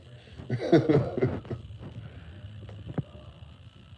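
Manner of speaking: breathy chuckle at his own line; amused laugh. Real, not acted. Streamer chuckling at chat's joke, amused laugh.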